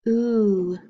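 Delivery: OO held as one long vowel; isolated letter sound. Shown as a demonstration. Teacher drilling sound cards, OO held alone. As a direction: draw out the middle of tooth, OO. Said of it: "do our letter sound cards now".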